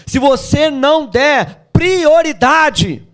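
fervent crescendo; building preaching. Real, not acted. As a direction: build it up and hammer the end.

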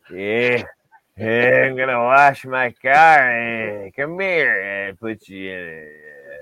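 slow, slurred growly drawl with stretched vowels; Stu Hart. Retold or imitated. Joking that Endicott people all talk like an old wrestler, drawling impression. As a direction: drag every word out, trailing into grunts. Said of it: "They talk like Stu Hart?".

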